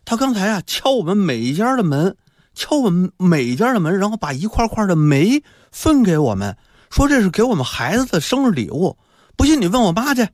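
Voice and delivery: put-on high-pitched tattling kid; another child. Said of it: "另一个孩子就说".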